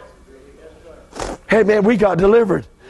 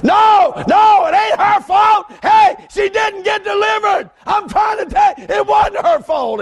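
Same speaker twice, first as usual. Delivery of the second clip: preaching shout; 12 semitones higher, slower than usual. Real, not acted. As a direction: cry it out to the congregation. Preacher defending Tammy, calling out.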